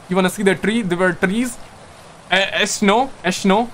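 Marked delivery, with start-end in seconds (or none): 2.3-3.7 "snow" as "eshno", vowel in front and sh for s; Pakistani pronunciation. Shown as a demonstration. Streamer giving examples, "snow" turned into "eshno". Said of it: "You don't say snow".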